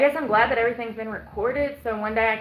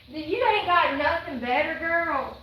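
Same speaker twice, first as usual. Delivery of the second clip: higher, slow sassy put-down; sassy mock scolding; 7 semitones higher, slower than usual. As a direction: tease her with sass. Mocking the pageant answer.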